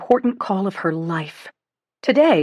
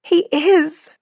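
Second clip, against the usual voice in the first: high, loud agreement; beaming agreement; joyful; 10 semitones higher, 4 dB louder than usual. A narrator's reading.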